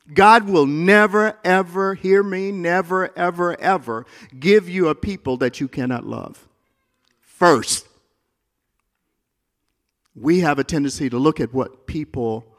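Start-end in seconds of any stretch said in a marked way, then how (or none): 0.1-7.9 loud and high, every never stressed; preacher pressing a promise on the congregation; fervent declaration. Real, not acted. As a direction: drive home the promise. Hear me.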